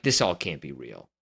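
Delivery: ordinary delivery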